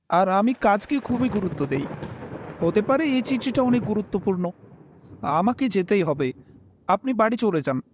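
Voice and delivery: ordinary delivery, nothing marked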